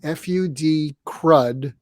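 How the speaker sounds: F U D spelled letter by letter, slowly. Shown as a demonstration. Spelling the technique's name slowly so viewers remember it. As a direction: spell "F U D" out slowly, then say "crud". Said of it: "is called the fud crud technique".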